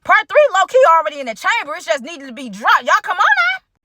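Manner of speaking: high, excited hype; a playful high-pitched tease about part three; hyped teaser. Real, not acted. Telling viewers part three is coming, excited and high.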